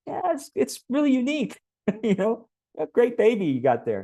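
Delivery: very high, awkwardly polite compliment; fake-praise it in a high, strained-polite voice; overly polite praise. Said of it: "everyone's gonna be so polite".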